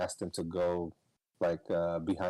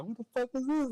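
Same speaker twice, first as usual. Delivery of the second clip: shocked quote. Retold or imitated. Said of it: "everyone was like".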